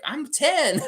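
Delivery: put-on high kid voice breaking into laughter; imitated little kid. Kid telling his game partner his age, imitated high voice. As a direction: pipe up as a kid, nearly cracking up. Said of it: "and the kid was like".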